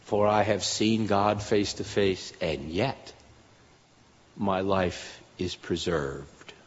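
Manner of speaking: low, soft and slow, fading to near-hush; hushed Jacob quote. Retold or imitated. Preacher quoting Jacob softly to the listeners, hushed and slow. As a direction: let it sink into reverent quiet. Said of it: "Or as Jacob put it".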